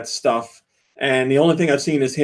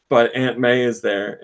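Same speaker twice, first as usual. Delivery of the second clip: ordinary talk, nothing marked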